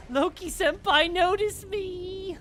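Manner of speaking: high, loud, stretched-out squeal; anime fangirl voice. Pretend. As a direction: squeal like a lovesick anime fan. Enemy voiced pleading for attention.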